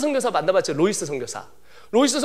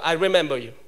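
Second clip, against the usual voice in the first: put-on American accent; 4 semitones lower, much slower than usual. The speaker answering the British teacher in an American accent. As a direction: say I remember you with a flat American r. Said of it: "저는 이제 미국 발음 하니까".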